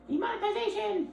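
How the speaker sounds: a high, wailing cry of Demonetization; mock dramatic wail. Mocking YouTube rules, high wailing shout. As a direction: wail it like a game show disaster.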